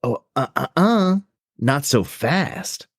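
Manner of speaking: hesitant then slow mock threat; mock villain. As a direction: drawl out not so fast like a villain. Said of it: "Wynn shows up and is like".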